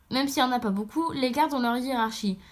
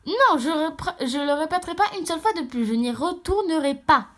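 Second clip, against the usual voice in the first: raised voice, acted refusal; protesting cry; 4 semitones higher, 4 dB louder than usual. Prisoner character refuses to go back to the cells, crying out in protest. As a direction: protest loudly, digging in. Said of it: "ne crie pas comme ça".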